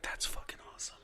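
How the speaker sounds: whispered deadpan sarcasm; unvoiced sarcastic whisper. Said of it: "And I'm like".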